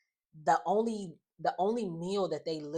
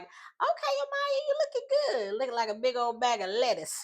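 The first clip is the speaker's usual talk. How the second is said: high-pitched mock hype, much higher; hyped-up compliment; 8 semitones higher, 3 dB louder than usual. [name] teasing Amaya in her green outfit, hammed-up hype. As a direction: hype someone up in an exaggerated high voice.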